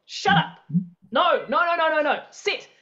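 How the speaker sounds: very direct, snapped orders to a dog; dog commands. Shown as a demonstration. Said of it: "Notice the way you talk to your dog".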